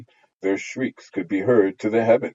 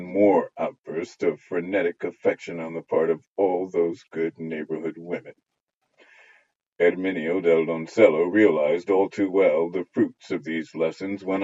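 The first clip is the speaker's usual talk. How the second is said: ordinary delivery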